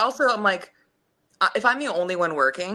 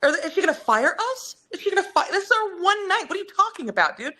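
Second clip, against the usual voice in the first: high, fast panicked retelling; mock panic at the wedding; panicked quote; 10 semitones higher, faster than usual. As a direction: rush it out high in alarm. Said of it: "And I'm like".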